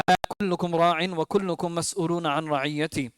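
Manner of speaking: rapid Arabic recitation of a hadith; recited hadith. Acted. Imam to parents, recites the hadith in quick Arabic. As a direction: chant the saying swiftly.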